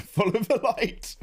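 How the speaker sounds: high, slow ghostly call; spooky voice. Pretend. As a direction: beckon like a ghost. Joking about dying.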